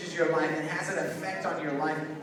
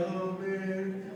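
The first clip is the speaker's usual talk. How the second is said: mock dejection; 8 dB quieter than usual. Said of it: "we get all dejected".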